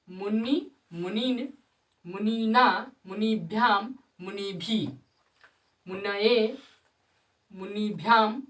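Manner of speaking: each declension form recited slowly and distinctly, with a pause after every word; sanskrit recitation. Shown as a demonstration. Teacher recites the declension of मुनि for students, clear and measured. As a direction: chant the forms of मुनि one by one for the class. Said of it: "एक बार और पढ़ा देते".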